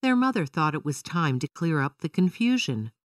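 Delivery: plain reading voice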